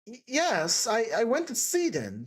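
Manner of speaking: faltering, mildly tearful confession; weeping. A narrator's reading. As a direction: stumble over the first words as if upset. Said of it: "she went on weeping".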